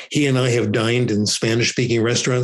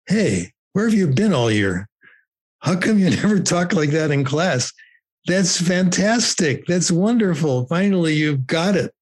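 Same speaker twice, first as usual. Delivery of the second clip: higher-pitched, amazed retold praise; delighted praise; 5 semitones higher than usual. Examiners to a student who suddenly sounds French, delighted praise. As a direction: imitate impressed teachers, pitch climbing into the praise. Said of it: "And they said".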